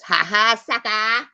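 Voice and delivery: loud, high ha ha sucker; taunting friends, loud and sing-song; mocking taunt. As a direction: jeer at them like a kid. Mocking friends who left.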